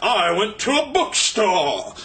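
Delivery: very slow, drawn-out mocking pretension; pretend-smart poser. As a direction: stretch every word like a self-important fool. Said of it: "they wanna pretend they're smart or something".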